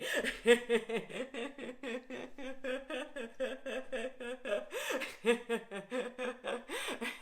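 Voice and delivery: long open laugh for no reason; just laughing. Shown as a demonstration. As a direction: laugh on and on at nothing. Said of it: "This first one's called Just Laughing".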